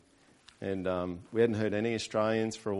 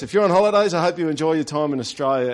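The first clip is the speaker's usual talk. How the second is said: imitated captain, raised and loud; captain's announcement; 4 semitones higher, 8 dB louder than usual. Plane captain addressing passengers nearing Brisbane, loud announcement. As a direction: announce it loudly to the whole cabin. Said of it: "captain came on and he announced".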